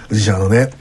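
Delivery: playing a sly salesman who flatters a grandpa; smooth salesman to grandpa. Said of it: "とかって言って".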